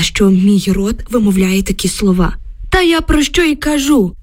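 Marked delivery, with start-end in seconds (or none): 2.7-4.1 put-on old man's high exclamation; emotional exclamation. Old neighbour insisting to the women, exclaiming high. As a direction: blurt it out, agitated. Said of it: "емоційно вигукнув дід".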